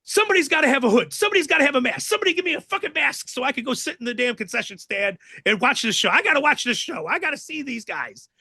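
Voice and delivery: high, fast, pleading demands; frantic begging. Retold or imitated. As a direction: beg for a mask in a jittery rush. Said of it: "We got".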